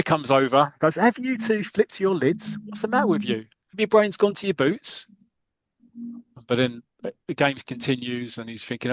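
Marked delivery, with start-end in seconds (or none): 1.0-5.1 high-pitched exasperated scolding; mock telling-off. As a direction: act out a captain ticking off two teammates. [name] scolding squabbling teammates.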